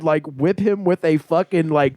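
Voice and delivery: ordinary talk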